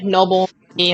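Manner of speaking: usual speaking voice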